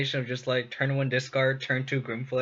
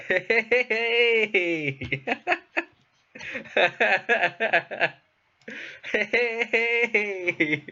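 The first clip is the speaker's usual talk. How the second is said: high excited hey calls; joyful reunion; 9 semitones higher, 4 dB louder, much slower than usual. Real, not acted. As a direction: greet a long-lost friend. Siege Rhino finally resolves.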